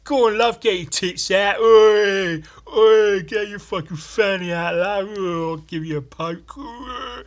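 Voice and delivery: loud high catcalls; catcalling builder. Retold or imitated. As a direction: yell it like a crude builder.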